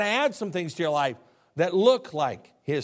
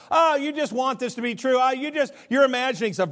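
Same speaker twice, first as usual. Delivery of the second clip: higher-pitched mock dismissal; 6 semitones higher, 3 dB louder than usual. Apostles dismissing the ladies' news, mocking. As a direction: lightly scoff in a raised voice. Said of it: "They're all mocking the ladies".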